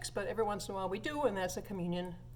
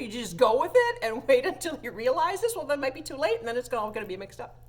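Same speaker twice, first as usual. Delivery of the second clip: ordinary delivery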